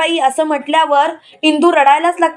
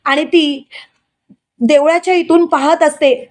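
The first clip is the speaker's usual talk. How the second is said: usual speaking voice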